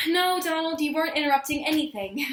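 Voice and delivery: sigh, then reassure him at the end of patience; exasperated reassurance. Acted. To Donald, the latest interrupter, exasperated.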